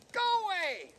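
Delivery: a tiny bird shrieking him off; baby bird yell. Acted. Baby raven shouts at Colin.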